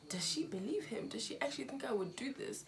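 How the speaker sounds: hushed, frightened self-questioning; breathy whisper of a hurt child doubting her mother; whispered inner doubt. Retold or imitated. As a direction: whisper the fear to yourself.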